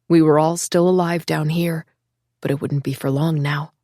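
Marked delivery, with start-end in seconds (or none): none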